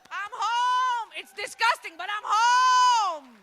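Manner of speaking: loud, high joyful cry; overjoyed shout. Retold or imitated. Woman back in Greece answering the cab driver, joyful shout. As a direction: cry it out in relief. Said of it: "I was like".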